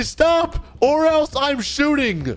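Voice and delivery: high, drawn-out warning; yelled police threat. Pretend. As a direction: play a cop threatening a runaway, slow and high. Police threat to fleeing suspect.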